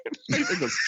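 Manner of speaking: ordinary delivery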